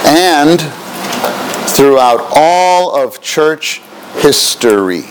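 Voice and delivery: slow, word by word dictation; dictating. Shown as a demonstration. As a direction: spell it out slowly for note takers. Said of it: "Please write that".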